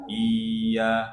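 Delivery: 'Iya' with the i dragged long; wrongly lengthened. Shown as a demonstration. Teacher showing the mistake, stretched i. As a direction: hold the first vowel of 'Iya' too long. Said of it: "Jangan juga dipanjangkan".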